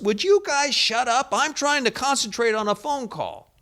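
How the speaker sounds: mock yell, high and sharp; retold yell. Irritated employee yelling at people in the back office, mock yell. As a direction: imitate the employee snapping at the room. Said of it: "and he yells".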